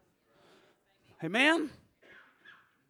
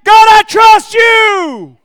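"God, I trust you" shouted, much higher and slow; David shouting his trust in God; shouted declaration; 8 semitones higher, 11 dB louder, much slower than usual. Acted. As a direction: cry "I trust you" out to heaven. David declaring faith aloud.

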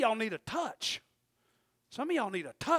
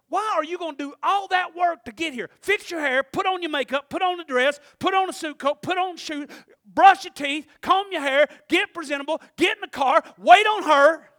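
rapid-fire listing, louder and higher; preaching; 8 semitones higher, 12 dB louder than usual. Acted. Preacher listing Sunday chores to the congregation, fervent.

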